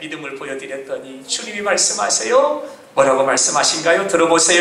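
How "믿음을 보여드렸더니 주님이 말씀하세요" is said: normal talking voice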